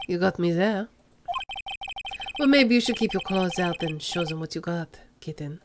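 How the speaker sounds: put-on low, soft French accent, purring; smooth French accent crooning at the rookie; a hint of a lazy French accent. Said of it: "made Armando have a French accent".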